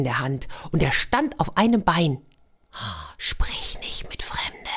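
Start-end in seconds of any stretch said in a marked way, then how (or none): 3.2-4.7 hushed, slow parental warning; a slow whisper of the old warning. Acted. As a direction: breathe it slowly like a remembered rule.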